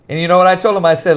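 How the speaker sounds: ordinary delivery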